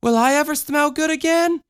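put-on high childlike voice, a touch of sadness; girl asking the old woman, wistful; little girl, wistful. A narrator's reading. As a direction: ask it like a hurt small girl. Michelle asks.

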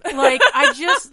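can't get through it for laughing. Real, not acted. Laughing while telling her cohost how excited she is. Excited about writing musical titles.

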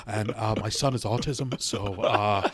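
low, mumbled, hesitant excuses; stammering son. Acted. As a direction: mumble it low and quiet, tripping over every uh.

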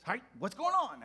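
high, loud, hearty preacher greeting; jolly pastor. Retold or imitated. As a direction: boom it out like a beaming old reverend. Voicing the pastor again.

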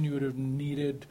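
ordinary talk, nothing marked

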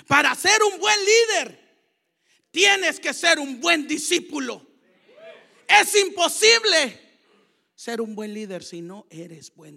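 loud, high, emphatic sermon; fiery preaching. Acted. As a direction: preach it out with full force. Preaching at full force.

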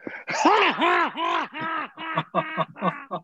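high, whooping burst of laughter; mocking laugh. The Birds laughing at his wrestling plan, high whoop. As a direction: imitate them cracking up at him. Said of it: "looked at each other like this and went".

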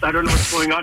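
normal talking voice

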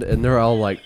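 usual speaking voice

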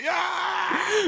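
high far-off gleeful yell; mock yell. [name] shooting into the air, high mock whoop. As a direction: whoop while firing into the air.

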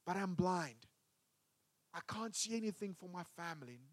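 a hint of quiet despair. Believer voicing blind Bartimaeus, soft and hopeless. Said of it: "You find yourself like Bartimaeus".

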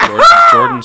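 shouted guess, high and loud; excited shout. Real, not acted. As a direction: blurt out the answer loudly.

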